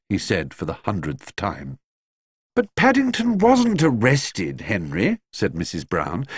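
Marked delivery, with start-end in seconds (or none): 2.5-5.2 much higher, slower motherly correction. A narrator's reading. Mrs Brown corrects her husband about Paddington, higher and patient. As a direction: gently set her husband straight.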